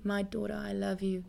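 soft, loving reassurance; tender. Acted. Preacher voicing God speaking to his daughter, tender. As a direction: drop the voice and comfort gently. God's loving words, re-voiced.

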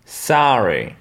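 American sorry; "Sorry" with an open A, "sahry"; American English. Shown as a demonstration. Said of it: "Pareil pour le mot désolé". (en américain, "sorry" avec un A ouvert)

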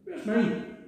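put-on child repeats the cheeky demand to Mum. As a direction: push it a second time, cheekily. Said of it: "That's cheeky".

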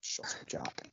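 hushed whisper aside. Acted. Aside hushed jab at Jack.

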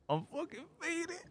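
high-pitched giggling through the words; giggly high voice. Retold or imitated. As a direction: laugh it out, stoned. Cousin voiced, high and laughing.

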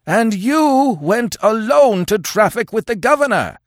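high, loud, accusing yell; frenzied yell. Acted. Old chief accuses Conan of treachery, yelling. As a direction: work up into a frothing frenzy. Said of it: "yelled the Afghuli".

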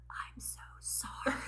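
silently mouthing the apology, just a whisper; whispered sorry, no voice at all; mouthing it through a car window; mouthed apology. Retold or imitated. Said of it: "I mouth".

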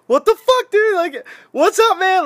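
high, loud joyful yell of disbelief; excited shout. Retold or imitated. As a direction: greet an old buddy with a big happy shout. Excited reunion greeting at airport.

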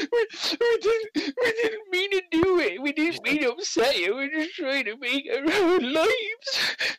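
put-on high, wailing children sobbing apologies; a gang of halfling kids in tears; bawling children. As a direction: blubber it out like crying kids. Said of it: "bawling their eyes out, just like".

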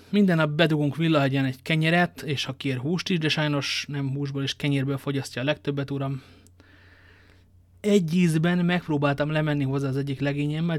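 plain reading voice

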